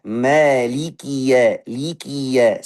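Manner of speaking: ya kept short at the end of "Maliki ya"; slow Quranic demo, "ya" not lengthened; short final vowel. Shown as a demonstration. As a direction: recite "Maliki ya" slowly, cutting "ya" short. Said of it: "Pendek saja".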